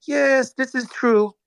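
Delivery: mock the CEO's cold, slow answer; emotionless Karpeles. CEO coolly admitting the missing coins, slow and unemotional. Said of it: "he just didn't have emotion about it".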